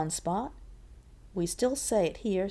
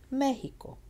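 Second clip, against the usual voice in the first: high "Me" in "Mexico", then falling; Spanish "Mexico" as ME-hi-co; stress on ME; 7 semitones higher than usual. Shown as a demonstration. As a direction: lean on the first syllable of "Mexico". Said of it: "It's here on the me".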